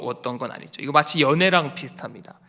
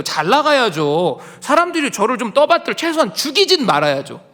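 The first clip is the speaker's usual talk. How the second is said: loud, high protest building to a cry at God; aggrieved Elijah pleading loudly, pitch soaring; resentful complaint; 5 semitones higher, 6 dB louder than usual. Acted. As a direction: pour out the grievance, voice climbing and pressing. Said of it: "엘리야가 원망하는 거예요".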